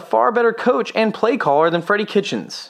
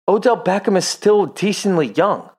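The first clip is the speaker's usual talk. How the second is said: ordinary delivery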